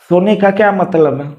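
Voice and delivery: ordinary delivery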